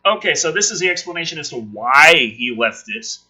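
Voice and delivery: ordinary talk, nothing marked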